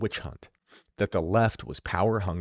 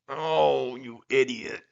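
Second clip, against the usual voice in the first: high, whiny mock putdown; pretentious whiner; 4 semitones higher, much slower than usual. Mocking his old pretentious self, high whiny scoff. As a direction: put on a whiny, superior voice. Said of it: "being this pretentious, whiny".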